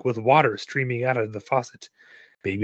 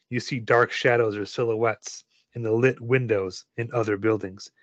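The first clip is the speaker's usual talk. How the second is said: ordinary delivery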